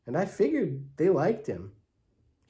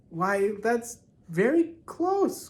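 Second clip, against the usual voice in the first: slow, higher, pleasantly surprised praise; 8 semitones higher, slower than usual. A narrator's reading. Mother praising Claude's guess, surprised and pleased. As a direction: sound delighted the kid got it nearly right. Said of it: "Mother looked surprised and a little pleased".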